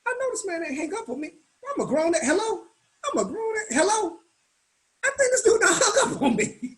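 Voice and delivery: high, incredulous voice breaking into laughter; disbelief. Retold or imitated. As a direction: act stunned that he hung up, half laughing. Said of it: "I'm looking at the phone like".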